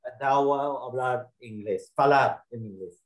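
Portuguese with an American English accent. Real, not acted. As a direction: speak Portuguese like an English-speaking learner. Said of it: "Meu português é mau, ruim".

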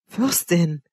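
ordinary delivery

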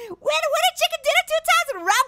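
high-pitched victory crowing; jubilant. Real, not acted. Player winning twice in a row, jubilant. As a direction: crow it high and elated.

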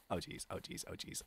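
whispered oh jeez under breath; nervous whisper. Real, not acted. Dark falls, nervous.

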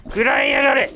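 loud, high battle yell; screaming attack name. Acted. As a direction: roar it as you strike.

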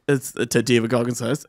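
ordinary talk, nothing marked

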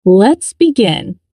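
normal talking voice

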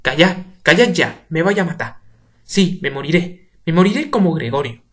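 frantic outcry. Acted. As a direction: wail in despair. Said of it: "sale el señor Juan enloquecido".

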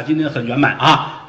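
plain reading voice

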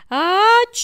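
a big loud achoo; loud sneeze. Acted. Prince sneezing at the perfume, loud sneeze. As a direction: sneeze it out hard.

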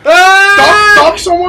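loud, high frightened yell; panicked shout. Real, not acted. Chased by enemy in game.

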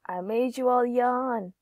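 usual speaking voice

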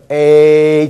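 "A" stretched into a long, steady "ei"; long vowel. Shown as a demonstration. Teacher showing the long form, "A" drawn out. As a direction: hold "A" out at length. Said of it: "就可以拉得长".

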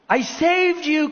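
high, loud, slow cry of hurt; lightly voiced woman crying out. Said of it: "cried the woman".

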